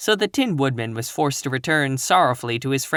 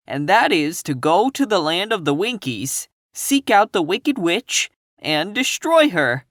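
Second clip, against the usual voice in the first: determined lion; 6 semitones higher than usual. A narrator's reading. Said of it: "returned the lion".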